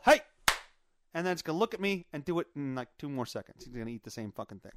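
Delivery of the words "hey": sudden sharp yell. Shown as a demonstration. Owner stopping a cat eating something, sharp yell. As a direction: shout it to startle a cat. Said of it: "clap and yell".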